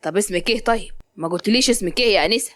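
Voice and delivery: a man recognising her, mocking; young man. Zein tells Malak he remembers her, low and mocking.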